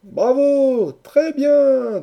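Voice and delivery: very high, loud cheers; cheering chorus. A narrator's reading. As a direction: applaud the speaker with loud acclaim. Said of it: "dit le chœur des amis". (acclamations fortes et aiguës)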